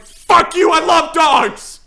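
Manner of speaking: high, slow, hammed-up furious yell; super triggered. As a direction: bellow it in mock outrage. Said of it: "super triggered".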